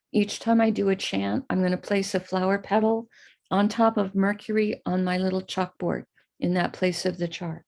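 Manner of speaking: normal talking voice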